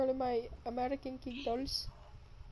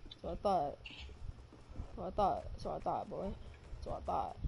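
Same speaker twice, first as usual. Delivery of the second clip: low smug murmur, fading quiet; low taunting; 6 semitones lower, 6 dB quieter than usual. Acted.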